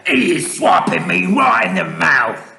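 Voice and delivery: high, outraged giant yell. A narrator's reading. Struck giant waking up, yelling. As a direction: yell it in pained protest. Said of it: "yelled the meat dripper".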